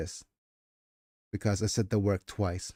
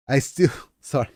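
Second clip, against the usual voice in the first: laughing through words; 4 semitones higher, 4 dB louder than usual. Real, not acted. Laughing breaks the reading.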